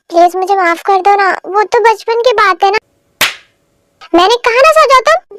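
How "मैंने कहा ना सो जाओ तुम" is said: high, irritated insistence; exasperated order. Acted. Wife ordering [name] to go to sleep, exasperated. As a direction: repeat the order with rising irritation.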